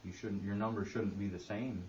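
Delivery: ordinary talk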